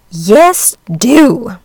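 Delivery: curt hen. Acted. Hen dismisses duckling.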